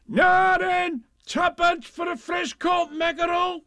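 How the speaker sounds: high, loud, slow seller's cry; fishmonger call. Acted. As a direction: cry fresh fish to the whole market. Market seller calling out.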